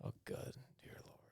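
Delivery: breathless whisper, barely voiced; whispered dismay. Real, not acted. Whispering to friends over the silly score total. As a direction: whisper it as if the sum is too much.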